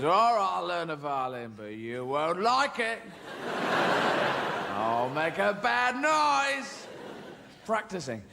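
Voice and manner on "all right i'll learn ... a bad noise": slow, drawn-out grudging threat; sulky kid. Acted. Kid giving in to violin lessons, sulky. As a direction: swoop and stretch the last words. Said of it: "So the kid goes".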